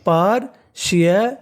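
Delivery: Partiality slowly split into parts; teacher's slow, broken-up partiality; syllable by syllable. Shown as a demonstration. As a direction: sound out partiality for a class. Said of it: "क्या meaning बन गई".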